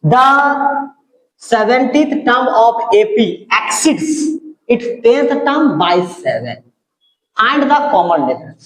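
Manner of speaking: deliberate, drawn-out reading; measured classroom read-aloud; slow question read-out. Real, not acted. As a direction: read the problem out slowly.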